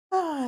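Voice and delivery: drowsy trailing sigh; drifting off to sleep; sleepy sigh. A narrator's reading. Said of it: "her head droops to one side".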